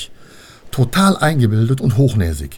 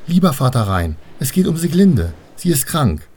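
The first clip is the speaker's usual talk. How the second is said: put-on higher, earnest child's appeal; pleading girl; 6 semitones higher, 3 dB louder than usual. Annabel turns to Vater Rhein, pleading bravely. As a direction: appeal to him in a raised girlish voice.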